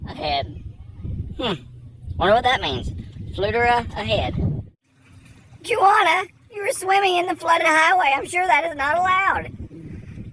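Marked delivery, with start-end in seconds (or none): 5.6-9.5 raised, higher scolding voice. Real, not acted. Vlogger scolds Joanna for swimming, raised and higher. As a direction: tell her off for swimming in the flood.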